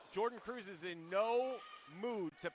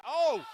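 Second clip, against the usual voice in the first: loud, high outburst; sudden shout of shock; startled "oh"; 7 semitones higher, 12 dB louder than usual. Real, not acted. Commentator cut short by a hit, startled shout.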